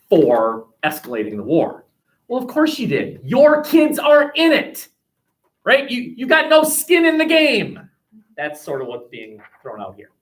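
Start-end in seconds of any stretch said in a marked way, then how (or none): 2.3-5.9 high, loud mock challenge; acted accusation. Acting out critics of senators. 5.9-7.9 high, loud mock challenge; loud, high-pitched acted jab; acted accusation. Acting out critics of senators.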